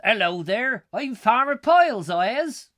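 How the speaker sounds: put-on loud, high comedy country bumpkin accent; Farmer Piles. Vlogger mocking his own regional accents, booming comedy farmer accent. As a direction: belt it out in a hammed-up rural farmer accent. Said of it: "comedy accent of Farmer Piles".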